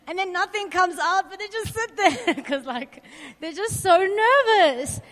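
amused, laughter bubbling up as you talk; laughing. Real, not acted. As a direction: tell it through giggles. Laughs while telling bathroom story.

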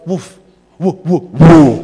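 imitated dog barks, the last ones loud and slow; dog barking. Shown as a demonstration. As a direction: bark like a big dog at the gate. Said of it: "I need to go".

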